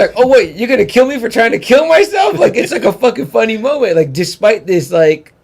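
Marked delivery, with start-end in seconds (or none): 0.2-2.4 loud, high-pitched incredulous retort; comic shouted disbelief, voice shooting up; mock incredulous. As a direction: act out outraged disbelief for laughs. Acted comic standoff retort.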